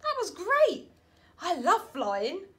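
high, loud joy; delighted. Acted. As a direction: bubble over with fun. Norman loves flying.

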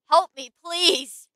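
loud, slow drawn-out plea; hammed-up cry for help. Playing a victim for the game stream, loud slow plea. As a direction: wail it like a horror victim. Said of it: "pull out that emotion".

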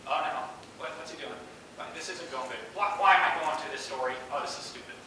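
muttered nagging self-doubt; self-reflective inner voice. Retold or imitated. Inner voice on your shoulder during an interview, muttered doubting. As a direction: fret under your breath like an inner critic. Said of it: "this voice kind of sits on your shoulder".

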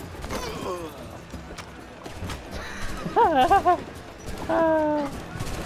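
a long burst of high real laughter; big delighted laugh. Real, not acted. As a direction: crack up at the two enemies, pitch jumping up. Laughs at the enemies.

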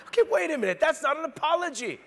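high, loud wait-a-minute objection; mock outrage. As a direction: cry out in comic disbelief. Preacher reacting to jerk apology.

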